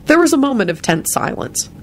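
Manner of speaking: plain reading voice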